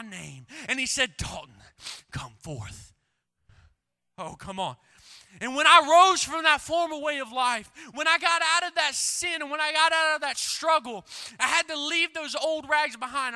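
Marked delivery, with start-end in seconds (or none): 1.2-2.9 low, slow, solemn command from beyond; deep voice of Jesus calling. Acted. Jesus calling Lazarus, voiced by preacher. 5.4-13.3 loud, high, driving sermon voice; fiery preaching. Acted. Preaching at full force.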